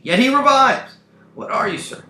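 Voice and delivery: high, loud hailing in a put-on voice; new role's voice. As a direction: call out urgently to a fallen stranger. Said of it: "He plays a new role, new accent".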